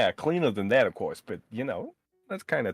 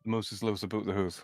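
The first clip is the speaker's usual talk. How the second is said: softer "hoose" for house, Canadian vowels; Canadian accent; 6 dB quieter than usual. Shown as a demonstration. Mimicking the Canadian saying back to a friend, softer Canadian accent. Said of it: "It comes across like that".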